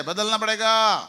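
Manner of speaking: loud rallying shout. Shown as a demonstration. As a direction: shout it out to the hall. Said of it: "जोर से".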